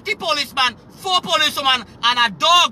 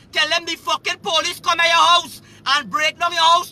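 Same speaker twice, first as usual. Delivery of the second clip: normal talking voice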